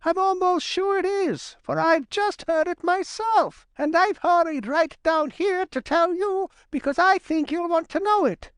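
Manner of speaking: very high-pitched, excited little chuck; eager Johnny Chuck. A narrator's reading.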